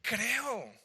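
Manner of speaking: high, loud exclaimed "Creo"; urgent cry. Acted. Father pleading with Jesús, crying out high and loud. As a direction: blurt out belief, high and loud. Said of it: "pero le dice".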